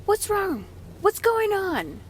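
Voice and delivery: high-pitched, triggered questioning. Retold or imitated. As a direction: call it out sharply, voice pitched right up. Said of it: "with a loud voice".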